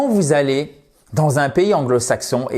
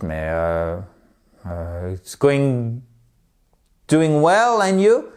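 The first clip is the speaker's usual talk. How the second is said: flustered French learner; 3 semitones lower, much slower than usual. Retold or imitated. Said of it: "Et là, vous dites".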